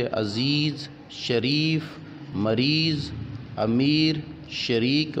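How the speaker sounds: long "ee" in अजीज, शरीफ, मरीज, अमीर, शरीक; full open ye. Shown as a demonstration. Teacher showing yaa-e maroof, शरीफ and अमीर with long clear "ee". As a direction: hold the "ee" of each word, अजीज to शरीक. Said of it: "वो ये जो खुलकर पढ़ी जाए".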